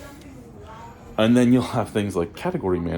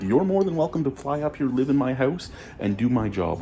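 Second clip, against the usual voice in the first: defiant challenge; 7 semitones higher than usual. Retold or imitated. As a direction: dare him to do it. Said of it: "I'm like".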